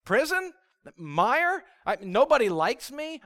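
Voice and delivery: high, loud incredulous complaint; exasperated disbelief. Acted. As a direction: protest to God, acting out the betrayed prophet. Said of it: "And Jeremiah's like".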